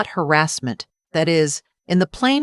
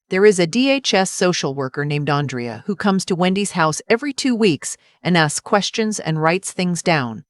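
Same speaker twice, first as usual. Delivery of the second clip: usual speaking voice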